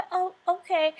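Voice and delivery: high, small mocking voice of a reluctant wife; mock meek reply. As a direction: squeak it out like a wife who'd rather not talk. Mimicking wife's reluctant reply.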